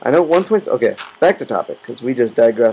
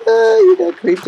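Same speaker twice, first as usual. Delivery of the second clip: high, teasing laugh of a viewer gloating; mocking viewer; 5 dB louder than usual. Viewer gloating that he got creeped, high mock jeer. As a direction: jeer like a friend who saw you blow up. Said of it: "you'll be like".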